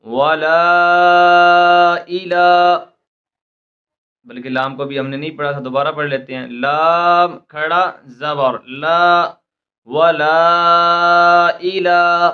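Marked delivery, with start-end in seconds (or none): 0.1-2.8 "वला इला" drawn out very slowly, the vowels lengthened; stretched madd. Shown as a demonstration. Teacher reciting the elongated madd for students, slow and stretched. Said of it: "इसको इंशाल्लाह हम खींच कर पढ़ेंगे". 9.9-12.3 "वला इला" drawn out very slowly, the vowels lengthened; stretched madd. Shown as a demonstration. Teacher reciting the elongated madd for students, slow and stretched. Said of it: "इसको इंशाल्लाह हम खींच कर पढ़ेंगे".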